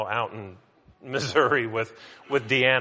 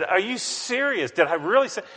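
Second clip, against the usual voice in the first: stunned disbelief; 7 semitones higher, 4 dB louder than usual. Retold or imitated. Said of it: "And I said".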